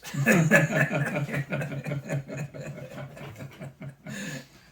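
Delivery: long, loud burst of laughter; real hearty laugh. Real, not acted. Student recalls an overheard German remark, hearty laugh.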